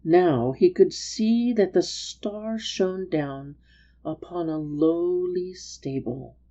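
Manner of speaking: ordinary delivery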